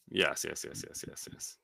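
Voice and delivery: whispering yes over and over; whispered. Real, not acted. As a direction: breathe the words out. Trailing repeated agreement.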